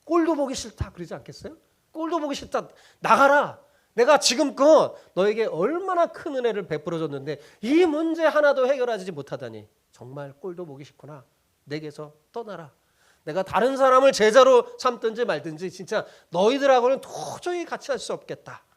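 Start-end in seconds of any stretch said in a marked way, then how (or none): 0.0-0.9 high, loud fed-up master; mock disgust at failing students; disgusted rebuke. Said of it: "무슨 말이 자연적으로 나오겠어요". 1.9-18.6 loud, higher scolding that fades at the end; a fed-up teacher throwing out his students; hammed-up furious master sending them away; angry dismissal. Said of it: "무슨 말이 자연적으로 나오겠어요".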